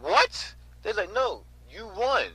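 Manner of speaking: high, loud astonished what; imitating the couple's shocked reply. Couple shocked at his loss.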